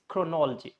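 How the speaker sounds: chronology as kro-NOL-jee; chronology with the second-last O dropped; stressed NOL in chronology. Shown as a demonstration. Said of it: "in this way it's".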